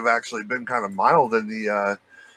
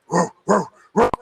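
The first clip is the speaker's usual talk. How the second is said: high dog-like barks; imitated barking; 8 semitones higher, 4 dB louder than usual. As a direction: bark like a dog in short bursts. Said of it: "I start barking".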